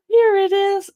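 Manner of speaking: excited reveal. Shown as a demonstration. Showing the baby the picture, loud joyful reveal. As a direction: burst out with the answer for a baby. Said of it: "read it with an enthusiastic tone of voice".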